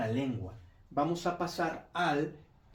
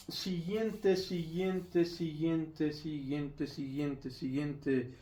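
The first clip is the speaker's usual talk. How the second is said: fading echo; 5 dB quieter, much slower than usual. Acted.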